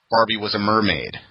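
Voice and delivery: ordinary delivery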